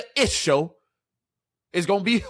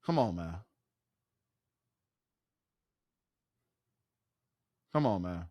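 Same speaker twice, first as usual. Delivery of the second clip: much lower and softer, a weary mutter; low muttered groan; 11 semitones lower, 12 dB quieter than usual. Real, not acted. Streamer mutters at the Browns' choice, low and fed up. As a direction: sigh it out deep, fed up.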